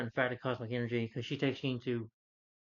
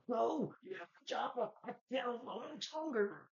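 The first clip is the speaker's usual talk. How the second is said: much higher, slow, strained mock-dying voice; mocking Fassbender's acting; 11 semitones higher, 5 dB quieter, slower than usual. Mocking Michael Fassbender's over-the-top scene, high slow strained parody. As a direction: ham up a struggling, over-acted dying plea. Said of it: "I'm laughing at him because".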